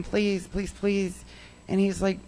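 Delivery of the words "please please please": soft, pleading please repeated. Retold or imitated. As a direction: beg him quietly, desperate. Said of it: "I begged him".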